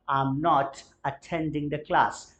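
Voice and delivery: spoken very slowly, word by word; slow model sentence. Shown as a demonstration. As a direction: lay out each word slowly for learners. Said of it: "मैं class attend नहीं कर रहा हूँ". (बहुत धीरे, शब्द-शब्द करके)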